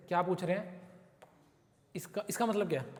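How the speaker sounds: normal talking voice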